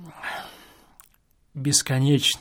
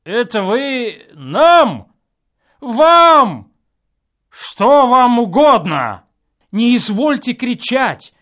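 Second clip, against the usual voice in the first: loud, higher indignant reply; indignant retort; 9 semitones higher, 9 dB louder, slower than usual. A narrator's reading. Neighbour answers the complaining guest, indignant and loud. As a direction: bristle at the complaint.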